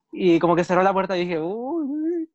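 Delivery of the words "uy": high, soft retold "uy"; a little high squeak of mock alarm. Retelling the door closing, high soft mock alarm.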